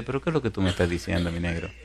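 quick, low-key aside; hushed, rapid street-talk reproach; mild colloquial chiding. Acted. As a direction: toss it off fast and soft. Acts Jesus chiding Philip, colloquially.

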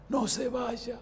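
súplica ronca y aguda, imitada; voz ronca. Retold or imitated. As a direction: imita al enfermo rogando con voz ronca. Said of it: "Así con su voz ronca me dice". (ruego con voz ronca)

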